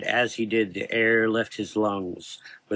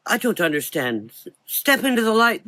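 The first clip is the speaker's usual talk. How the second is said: put-on high, louder frightened boy; scared boy; 12 semitones higher, 4 dB louder than usual. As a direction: plead in confusion and fear.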